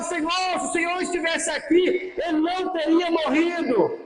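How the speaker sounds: high, pleading lament as Mary; acted weeping plea, pitched high; tearful lament. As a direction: plead high and grieving, without real sobs. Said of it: "Maria começa a chorar".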